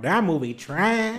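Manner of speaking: slow, drawn-out dismissal; dismissive verdict. Retold or imitated. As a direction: drag it out like a harsh critic. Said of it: "I'm gonna be out there talking about".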